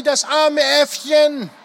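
high, drawn-out wail of pity; crying out. A narrator's reading. Ellie pities the little monkey caught by the harpy, crying out. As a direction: cry out high and slow. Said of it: "schrie Ellie".

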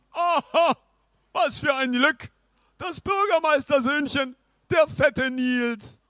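high, loud gleeful knight; joyful outburst. Acted. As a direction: burst out with delight. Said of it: "stieß der Ritter voller Freude hervor".

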